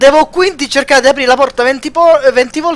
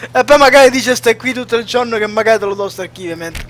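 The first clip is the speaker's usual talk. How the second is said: normal talking voice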